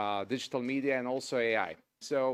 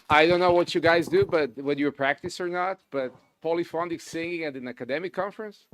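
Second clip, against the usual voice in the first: excited; 4 semitones higher, 8 dB louder than usual. Real, not acted. Praising the conference singing, enthused.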